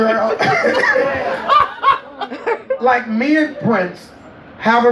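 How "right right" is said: laughing. Real, not acted. Laughing agreement.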